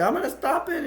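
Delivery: a man losing control, voice shooting up; frantic Spider. Retold or imitated. Spider grabbing a knife to stop it, panicky and high.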